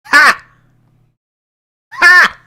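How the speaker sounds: loud, high-pitched gloating "Ha!"; single explosive shouts of mock laughter; triumphant "ha". Real, not acted. Streamer proved right about the song, crowing at chat.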